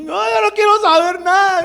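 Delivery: high, loud hammed-up wail; mock tearful refusal. Weeping man refusing to leave his tent, mock wail. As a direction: bawl it like someone who won't be consoled.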